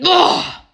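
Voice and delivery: loud, high exasperated "ugh"; angry groan. Real, not acted. Ranting at judgmental churchgoers, a loud angry groan. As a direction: let out a furious groan of disgust. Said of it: "I'm so mad".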